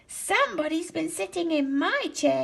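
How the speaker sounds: put-on Baby Bear finding his broken chair, small and high; little Baby Bear voice. A narrator's reading. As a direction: sound like a tiny upset bear cub. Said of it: "he said in his little voice".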